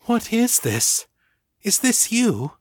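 awed and startled at the link; surprised. A narrator's reading. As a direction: Ava amazed, asking Danny. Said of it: "surprise spilling over the rest".

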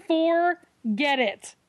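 slow emphatic dismissal. Real, not acted. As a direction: stretch it out, fed up. Drawn-out repeat of dismissal.

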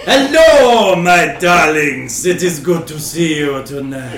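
slow, loud Dracula-style greeting; a hammed-up vampire count, drawn out; theatrical Transylvanian host welcoming guests. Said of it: "They could be from Transylvania".

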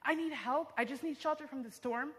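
high-pitched call for help, mildly tearful; retold tearful plea. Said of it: "and I'm crying, and I say".

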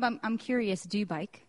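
normal talking voice

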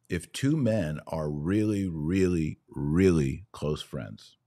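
slow, drawn-out emphasis on really. Real, not acted. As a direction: stretch each really to stress it.

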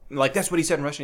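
ordinary talk, nothing marked

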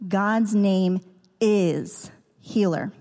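slow, heavy stress on is; slowed-down emphasis on is. Shown as a demonstration. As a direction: say it very slowly and lean on is. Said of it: "I emphasize that two little letter word is".

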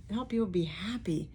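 breathy whisper. Real, not acted. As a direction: drop to a whisper. Emphasising her purpose.

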